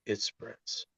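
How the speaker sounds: soft, eerie repeated hush; a quiet creeping murmur. Acted. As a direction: let it creep out softly. Dramatic hushed rant.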